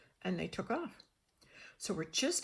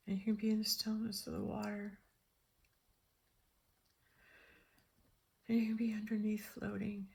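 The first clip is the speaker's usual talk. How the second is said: soft, hushed, meditative voice; calm visualization; 5 dB quieter than usual. Real, not acted. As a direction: lull them into stillness.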